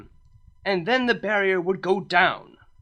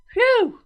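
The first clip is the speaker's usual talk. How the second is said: sigh of relief; 7 dB louder than usual. A narrator's reading. Relief after Cadance speaks.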